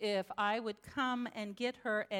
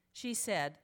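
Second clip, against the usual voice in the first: ordinary talk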